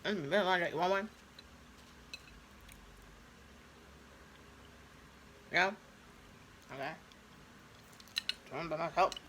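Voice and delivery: talking with mouth full; words round a bite of food; chewing steak. Real, not acted. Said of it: "talking with my mouth full".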